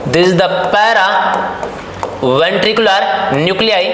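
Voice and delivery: paraventricular nuclei drawn out very slowly, syllable by syllable; slow dictation. Shown as a demonstration. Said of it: "इसको आपने बोला था paraventricular". (paraventricular nuclei को बहुत धीरे, अक्षर-अक्षर करके)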